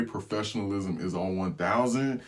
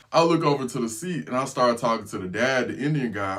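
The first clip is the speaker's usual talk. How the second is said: normal talking voice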